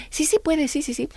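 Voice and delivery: put-on quick, eager little girl answering; breathless yes from a keen pupil; mock little girl saying yes; eager child.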